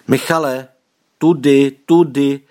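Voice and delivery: urgent guiding cry in a storyteller's voice; calling out loudly. A narrator's reading. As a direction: call to someone far away, short bursts. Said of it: "vykřikl, jak nejhlasitěji dokázal".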